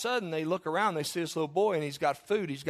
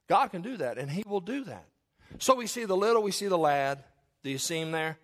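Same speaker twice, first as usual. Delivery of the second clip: ordinary talk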